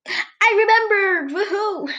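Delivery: real high, drawn-out cheer; excited triumph. Real, not acted. Podcaster suddenly remembering her forgotten topic, excited triumph. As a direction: celebrate it in a high, rising burst.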